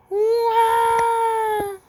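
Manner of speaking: high, loud comic wail; bear wailing.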